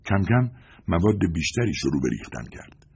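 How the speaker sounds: plain reading voice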